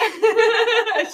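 a big high burst of laughter; can't hold the laugh in; loud laugh burst. Real, not acted. Speaker cracks up at own story.

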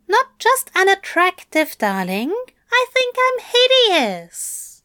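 high, loud self-pitying Aziraphale. Acted. Aziraphale calls himself hideous to Crowley, high and loud. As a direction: raise the pitch and lay on the gloom.

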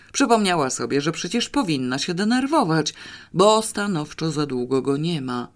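plain reading voice

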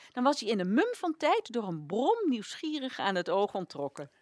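normal talking voice

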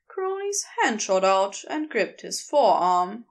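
usual speaking voice

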